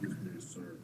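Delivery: soft, hushed library voice; library whisper. Acted. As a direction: drop to a hushed library murmur. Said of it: "Keep it down, friend".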